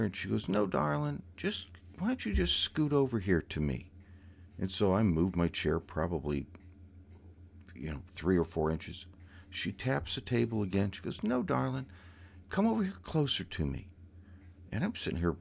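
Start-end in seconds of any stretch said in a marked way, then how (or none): 0.5-3.9 higher, lilting coax; a gentle Southern aunt urging closer; coaxing a nephew to scoot closer, sweet and lilting; sweet insistent aunt. Retold or imitated. 11.2-13.9 higher, lilting coax; a gentle Southern aunt urging closer; coaxing a nephew to scoot closer, sweet and lilting; sweet insistent aunt. Retold or imitated.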